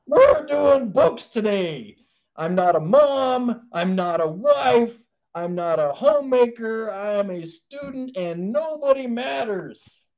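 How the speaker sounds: pushy student learner. Retold or imitated. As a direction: play the learner who shoves everyone aside, slowly. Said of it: "she will push everyone away from the table like".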